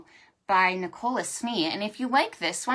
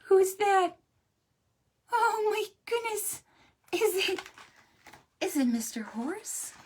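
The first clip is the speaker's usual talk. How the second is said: curious surprise; 11 semitones higher than usual. Acted. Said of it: "animals asking".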